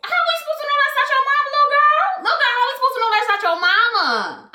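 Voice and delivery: mock indignant squeal. Retelling her reaction to the girl, high fast mock outrage. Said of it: "I was like".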